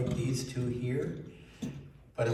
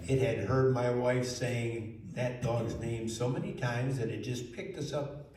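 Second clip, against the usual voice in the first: plain reading voice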